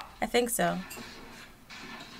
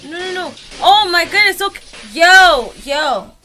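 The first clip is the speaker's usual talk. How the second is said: loud, high stop stop; pleading shout; 4 semitones higher, 6 dB louder than usual. Real, not acted. Begging the raging gamer on screen, loud pleading shout. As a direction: yell at the screen to make him stop.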